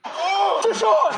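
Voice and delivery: high shouted fish on; excited catchphrase. Retold or imitated. To viewers, an excited high cry of fish on. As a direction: yell it like a hooked fish.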